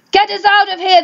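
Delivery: high mock yell for rescue. Stuck family calling out, high mock yell. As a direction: pitch it up like a crowd calling. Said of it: "they yelled".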